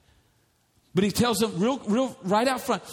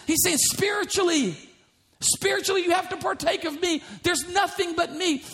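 fervent preaching; 10 semitones higher than usual. Real, not acted. Preaching climax on Jesus.

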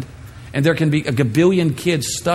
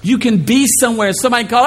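ordinary talk, nothing marked

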